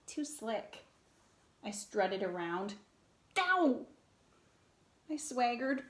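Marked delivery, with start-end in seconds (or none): 3.4-3.8 sharp high yelp of pain. A narrator's reading. Narrator yelping after a clumsy strut. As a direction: cry out as you bump into something.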